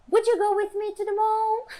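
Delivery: thinned, high-pitched "Would you go with me to the mall?"; exaggerated question intonation. Shown as a demonstration. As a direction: overdo the question, pitching every word up through "mall". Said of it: "Não tão exagerado". (pergunta exagerada, voz bem afinada até "mall")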